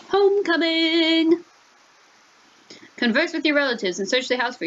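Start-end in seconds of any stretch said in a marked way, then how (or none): 0.1-1.4 high, drawn-out singsong call. Pretend. Player announcing the arrival home, drawn-out call.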